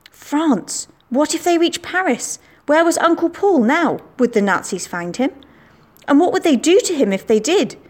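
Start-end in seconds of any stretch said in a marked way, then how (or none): 0.1-0.9 France! jumps high in shock; alarmed exclamation. A narrator's reading. Narrator realising the Nazis are in France, alarmed. 1.1-4.0 anxious worry. A narrator's reading. Anxious thoughts about Uncle Paul.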